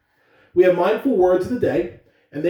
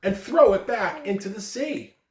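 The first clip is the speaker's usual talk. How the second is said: story narration; 5 semitones higher than usual. A narrator's reading. Principal reads parable aloud.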